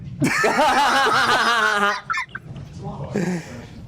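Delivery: sustained burst of real laughter; helpless laughing at a joke; long laugh. Real, not acted. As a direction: laugh hard and let it run on. Laughs at the super chat.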